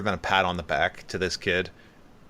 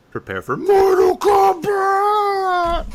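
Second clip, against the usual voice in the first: very high, loud, slow drawn-out battle cry; hammed-up arena announcer shout; Mortal Kombat announcer; 8 dB louder, much slower than usual. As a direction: boom it like a fighting game announcer. Mock fight announcer call.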